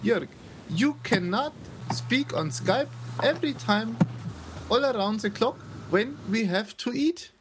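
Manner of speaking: high, slow playful telling-off in English; mock stern scolding. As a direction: scold him like a strict parent, jokingly.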